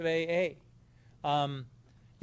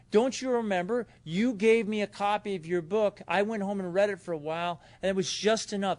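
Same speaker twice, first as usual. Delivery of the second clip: pitched up and eager; eager, raised voice; excited; 5 semitones higher, 4 dB louder than usual. Retold or imitated. Grateful man recounting recovery.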